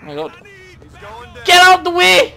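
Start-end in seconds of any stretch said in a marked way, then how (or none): 1.4-2.3 high, loud impatient shout; loud gamer road rage; yelling at traffic. Pretend. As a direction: yell them out of your path.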